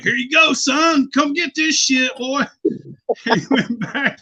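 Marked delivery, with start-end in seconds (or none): 0.0-2.4 high, loud come-and-get-it call; imitated calling out. Luring the dude to the pizza salt, calling out. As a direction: call him over, pitched well up. Calls coworker over to prank line.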